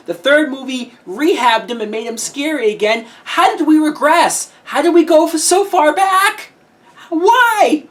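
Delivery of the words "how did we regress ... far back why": high, loud incredulous rant; real fan outrage, pitched way up; exasperated outcry. Real, not acted. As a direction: demand an answer in loud disbelief. Ranting at the film's regression.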